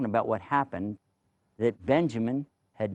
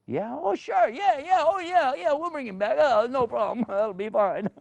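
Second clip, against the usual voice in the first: high, eager nervous yeahs; nervous brother; 11 semitones higher than usual. Acted. Brother to the king, high and nervously agreeing. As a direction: squeak out a nervous, overeager yes with a laugh.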